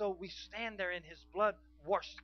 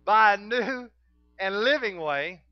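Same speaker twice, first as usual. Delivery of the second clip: loud, raised scripture proclamation; full-force preaching; 5 semitones higher, 14 dB louder than usual. Real, not acted. Preacher reading the new and living way to the congregation, full-force preaching. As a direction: proclaim the verse to the whole room.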